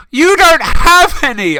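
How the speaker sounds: very high, loud, slow yell; yelled madly. A narrator's reading. Ebony yelling madly at Snape, high and loud. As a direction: scream it like a madwoman. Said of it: "I yelled in madly".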